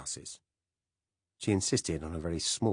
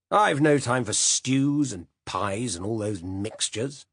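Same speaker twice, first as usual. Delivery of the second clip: slow, louder grumbling uncle; gruff old uncle; 5 semitones higher, 6 dB louder, slower than usual. Acted. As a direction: lay down the law like a stubborn old man. Uncle Silas grumbling at dinner.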